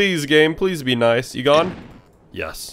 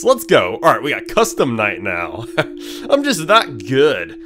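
ordinary talk

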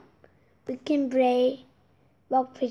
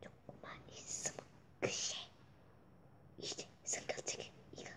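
whisper; 22 dB quieter than usual. Real, not acted. Whispered asides.

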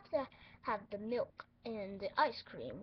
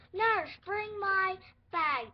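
slow, loud, higher bossy command; bossy doctor order; 6 semitones higher, 10 dB louder, slower than usual. Pretend. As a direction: order the nurse about grandly.

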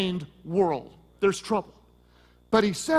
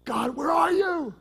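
anguished cry to God; 8 semitones higher than usual. Retold or imitated. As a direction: imitate a desperate believer calling out. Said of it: "What do you see so often?".

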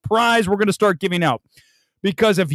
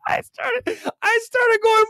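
laughing; 13 semitones higher, slower than usual. Real, not acted. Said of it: "that's where I started laughing".

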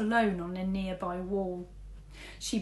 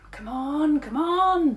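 rising, louder urging; will the rat on; 8 semitones higher, 10 dB louder, slower than usual. A narrator's reading. Girl urges rat silently.

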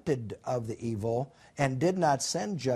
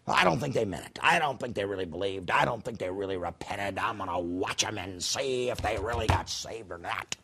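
lower, softer, grumbling; a sulky watcher muttering doubts; suspicious Jonah; 7 semitones lower, 4 dB quieter than usual. Retold or imitated. Jonah watching Nineveh from the east side, low grumbling. Jonah brooding outside the city.

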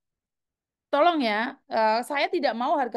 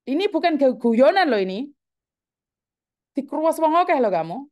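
angry warning; 6 dB louder than usual. Real, not acted. Host warns caller angrily.

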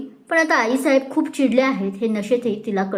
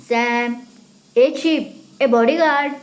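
put-on mild drunken calling; slower than usual. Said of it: "थोडं मोठ्याने बडबड करत".

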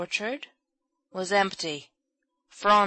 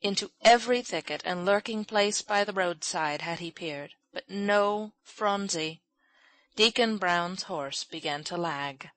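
ordinary delivery, nothing marked

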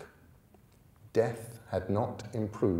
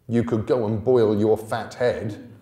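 rude retort; 8 dB louder than usual. Acted. Thackeray insulting Bod.

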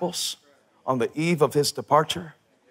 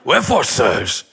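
mock British accent, slow and drawn out; a deliberately bad British accent, stretched slow; 4 semitones lower than usual. Shown as a demonstration. Pastor demoing a joke line to the church in a bad British accent. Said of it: "do it with a British accent".

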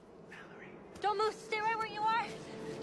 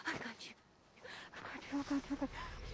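soft, lower breathless comfort; relieved murmur; 5 semitones lower, 7 dB quieter, faster than usual. Acted. Mother holds her found child, soft and relieved.

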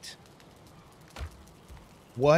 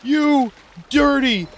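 mock angry shout; 12 semitones higher, 8 dB louder than usual. Gamer cursing the lizard that sank his boat, mock fury. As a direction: drag out each insult at full volume.

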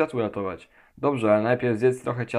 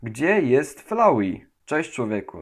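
ordinary talk

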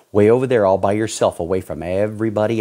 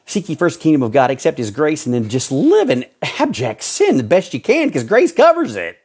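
fast, higher, louder sneering parody of the verse; mock version of "Seek ye first the kingdom of God" rushed and raised; mocking misquote; 6 semitones higher, 4 dB louder than usual. Shown as a demonstration. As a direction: rattle off "Seek ye first" like a smug hypocrite.

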